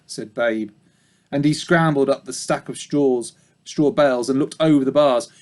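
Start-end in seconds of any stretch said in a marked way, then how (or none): none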